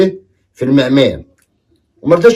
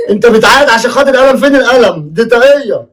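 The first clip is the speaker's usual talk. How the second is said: higher, exaggerated father; comic father; 7 semitones higher than usual. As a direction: play the dad, baffled. Father voice, comic high pitch. (أب مستغرب بصوت أعلى)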